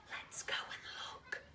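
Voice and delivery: soft unvoiced whisper; hushed whisper. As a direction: breathe it so nobody hears. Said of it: "whispered Kipper".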